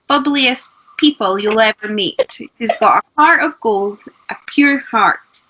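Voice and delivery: ordinary delivery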